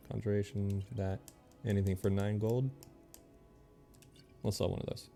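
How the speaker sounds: usual speaking voice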